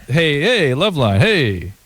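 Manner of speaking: slow, drawn-out mock radio greeting; imitating his opener. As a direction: stretch the hey like a lazy host. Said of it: "it's just".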